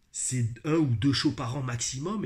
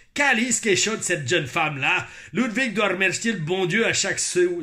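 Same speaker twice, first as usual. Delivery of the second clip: raised, hammed-up Québécois; Quebec accent; 3 semitones higher, 3 dB louder than usual. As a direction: lay the Quebec accent on thick. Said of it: "notez mon accent québécois". (accent québécois exagéré)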